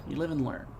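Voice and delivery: murmured under the breath; quiet resigned echo. Shown as a demonstration.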